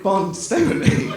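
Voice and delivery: normal talking voice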